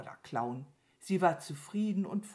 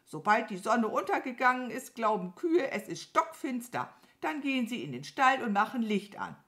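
hohe, laute, höhnische Krähe; spottende Krähe; 8 semitones higher, 5 dB louder than usual. Acted. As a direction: die ängstliche Kuh aufziehen. Krähe verspottet Mama Mu. (spöttisch zeternd, hoch)

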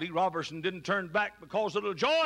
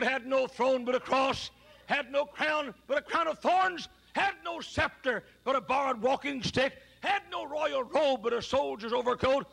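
rising, shouted sermon cadence; fiery preaching; 8 semitones higher than usual. Acted. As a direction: preach it with mounting fire. Preaching at full force.

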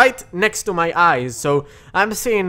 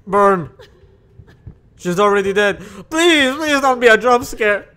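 ordinary talk

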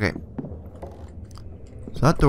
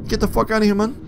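rattled, pitched-up shout; alarmed; 9 semitones higher than usual. Real, not acted. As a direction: raise your voice to ward off the pursuer. Warding off pursuing Steve.

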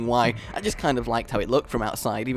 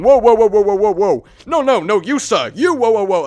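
loud, high heckling chant; rowdy MP; 6 semitones higher, 8 dB louder than usual. Retold or imitated. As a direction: shout down the other bench. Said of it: "basically just going".

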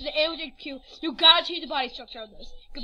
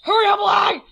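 loud, higher-pitched shout; impatient yell; 5 semitones higher, 12 dB louder than usual. Real, not acted. Gamer fed up with game lag, loud impatient shout.

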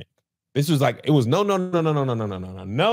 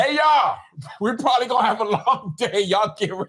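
imitating Marcus; 6 semitones higher than usual. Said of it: "Then Marcus comes in".

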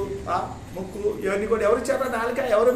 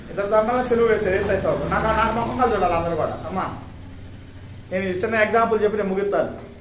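ordinary talk, nothing marked

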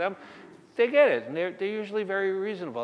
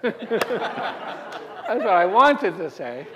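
slow, louder, laughing through it; 6 semitones higher, 6 dB louder, much slower than usual. Real, not acted. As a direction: laugh the confession out. Laughs at own joke.

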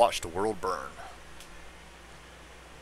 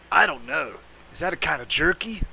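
werewolf; 5 semitones higher than usual. Acted. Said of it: "I got the werewolf's voice down pat".

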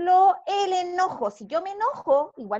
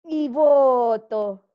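a long, loud, audible breath out; loud slow exhale; 3 semitones lower, 8 dB louder, much slower than usual. Shown as a demonstration. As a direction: breathe out loudly, dragging the words. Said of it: "breathing demo, exhale". (exhalación fuerte y lenta)

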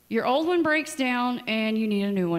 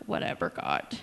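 whispered, dismissive "Whatever, God"; sulky whisper; 5 dB quieter than usual. Retold or imitated. Sulking back at God after his cheer, in a whisper. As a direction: sigh it out defeated.

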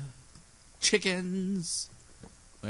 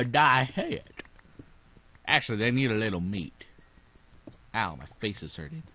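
usual speaking voice

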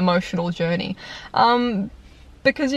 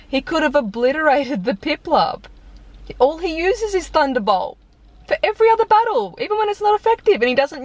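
rising exasperated rant; 8 semitones higher than usual. Real, not acted. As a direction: wind yourself up higher and higher in disbelief. Ranting about Pikachu's moves.

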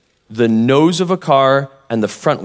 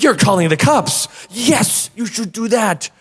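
eager agreement; 9 semitones higher than usual. Retold or imitated.

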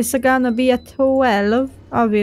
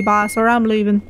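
ordinary delivery

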